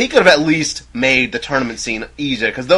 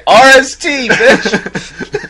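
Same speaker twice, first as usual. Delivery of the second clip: loud, high joke retort breaking into laughter; playful shout, laughing; mock defiant comeback; 12 semitones higher, 10 dB louder, much slower than usual. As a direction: belt it out as a cheeky comeback.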